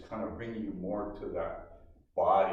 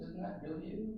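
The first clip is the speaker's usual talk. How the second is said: soft echoed question; 8 semitones higher, 8 dB quieter than usual. Shown as a demonstration. Repeats question more softly.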